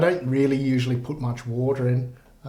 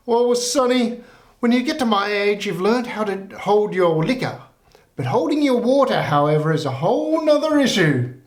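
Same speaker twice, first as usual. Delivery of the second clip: put-on higher old granny voice, knowing; sly old lady landing the joke, pitched up; old lady punchline; 6 semitones higher than usual.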